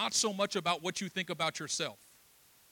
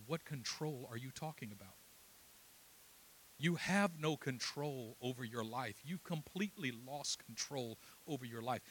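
low, soft, deliberate challenge; quiet confrontation; 4 semitones lower, 8 dB quieter than usual. Retold or imitated. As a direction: press the truth home in a hushed voice. Quiet confrontation with the man retold.

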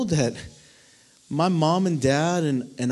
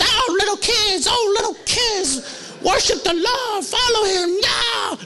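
much higher, pleading mock sermon to kids; earnest kids' preacher. Young preacher begging junior highs to worship, much higher. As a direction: ham up a desperate young preacher. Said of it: "I'm preaching away".

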